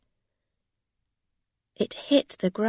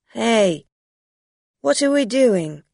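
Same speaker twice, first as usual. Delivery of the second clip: loud confused; 4 semitones higher, 9 dB louder than usual. Acted. Drunk man confused.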